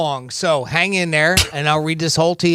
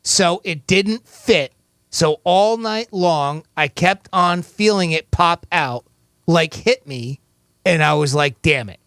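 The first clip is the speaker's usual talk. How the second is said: slow, careful reread; slow read again; slower than usual. Shown as a demonstration. Host rereads the quote slowly to the caller. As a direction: spell it out slowly one more time. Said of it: "I'll read it again".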